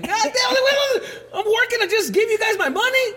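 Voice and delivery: high indignant yell through laughter; outraged teen. Retold or imitated. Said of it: "And I'm like".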